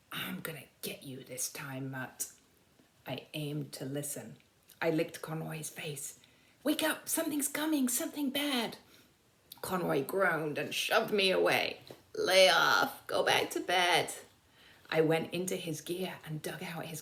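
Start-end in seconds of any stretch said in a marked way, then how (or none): 0.1-2.3 low threatening whisper from the gut; menacing whisper. Acted. The gas in his gut threatens Stubby, low menacing whisper. 6.6-8.8 high, urgent yapping plea; much higher, pleading for him to wake; urgent dog voice. Acted. The dog Stubby urges sleeping Conroy awake, high and urgent. 12.2-14.1 louder, grumbling brush-off; a touch of a cranky soldier; grumpy soldier. Acted. Conroy grumbles at his dog to go back to bed, irritable.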